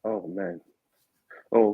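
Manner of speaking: normal talking voice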